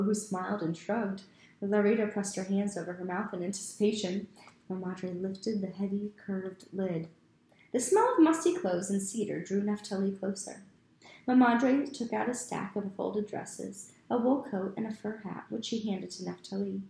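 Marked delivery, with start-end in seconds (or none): none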